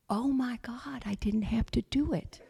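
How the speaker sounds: awed whisper. Retold or imitated. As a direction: breathe it in disbelief. Relieved inner reaction.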